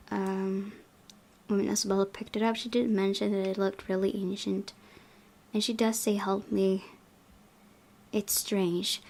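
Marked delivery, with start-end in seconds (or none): none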